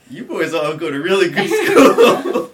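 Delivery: loud, high, drawn-out cop voice, breaking into laughter; mock exclamation. Cop reading the boys' IDs, loud incredulous exclamation. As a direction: exclaim it slowly, imitating a baffled cop.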